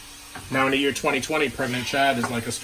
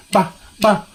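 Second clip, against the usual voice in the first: a louder punchy bah bah; 5 semitones higher, 6 dB louder than usual. Pretend. Host belting the jingle tag to viewers. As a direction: bark out the bah bah. Sing-song intro.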